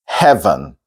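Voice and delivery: "heaven" as "hev'n"; nearly silent E. Shown as a demonstration. Teacher introducing another word, reduced "heaven". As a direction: let the second e of "heaven" almost vanish. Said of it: "E is almost silent".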